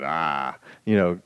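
usual speaking voice

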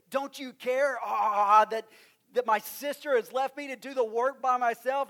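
loud, high, exasperated outburst; Martha's loud, exasperated complaint to Jesus; angry complaint. Retold or imitated. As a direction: vent at Jesus, fed up. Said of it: "She's angry".

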